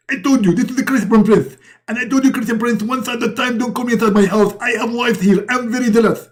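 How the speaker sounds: high-pitched jealous prophet voice; shrill protest over the phone; jealous Muhammad. Acted. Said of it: "and Muhammad, he answer".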